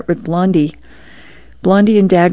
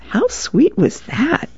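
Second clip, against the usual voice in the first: touched dad; 9 semitones higher, 4 dB quieter, slower than usual. Acted. Dad touched by daughter's praise.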